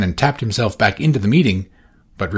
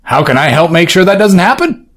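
higher, louder keen offer; eager Orsino; 9 semitones higher, 9 dB louder than usual. Acted. Orsino eagerly offering Valentine help. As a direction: sound bright and willing.

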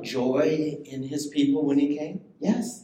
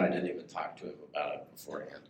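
quick quiet aside; 11 semitones lower, 8 dB quieter, much faster than usual. Real, not acted. Confessing aside to congregation.

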